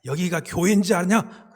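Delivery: loud, high imitated outburst of indignation; scolding elder. Elder telling off people fighting on subway, imitated angry shout. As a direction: shout it as an exasperated rebuke. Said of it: "호통치면서 야단을 치시는데".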